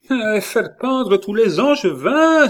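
loud, high-pitched exclamation of amazement; astonished cry. A narrator's reading. Catherine reacting to the king's threat to hang everyone, astonished cry. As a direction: cry out at an outrageous idea. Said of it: "avec cet étonnement".

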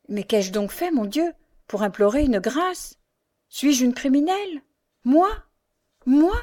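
distressed questions rising high; indignant disbelief. A narrator's reading. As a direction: protest in mounting, incredulous alarm. Henriette protests at word grace.

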